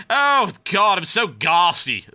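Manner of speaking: exaggerated posh British, high and loud; mock British. Caster mocking what people think British speech is, hammed-up British accent. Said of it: "some people think the British people like".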